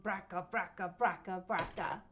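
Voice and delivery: rattling bracka, bracka; old car rattle. Shown as a demonstration. Showing kids an old car: rattling bracka. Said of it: "They make sounds like this".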